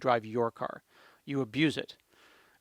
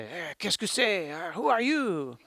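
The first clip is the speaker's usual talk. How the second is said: put-on slow, higher, puzzled French-Canadian accent; 3 semitones higher than usual. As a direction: play the confused French-Canadian on the phone, drawn out. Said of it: "I call the French Canadians".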